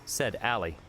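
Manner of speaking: ordinary delivery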